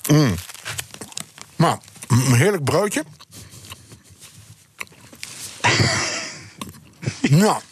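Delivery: talking with a mouth full of bread; words round a bite of buttered bread; chewing while talking. Real, not acted. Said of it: "En dan moet je met volle mond weer".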